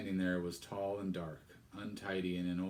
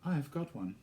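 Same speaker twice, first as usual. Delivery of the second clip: put-on proud young girl; 7 semitones higher than usual. Girl proudly tells vicar she has the book. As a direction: say it with a touch of pride. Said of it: "not without pride".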